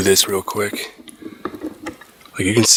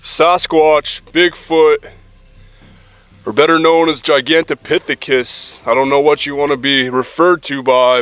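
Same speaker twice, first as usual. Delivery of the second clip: high loud calls into the woods; calling out; 8 semitones higher, 5 dB louder than usual. Real, not acted. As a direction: call out to the creature. Calling out to Bigfoot.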